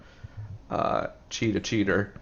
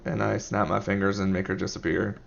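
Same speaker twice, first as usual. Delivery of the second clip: ordinary delivery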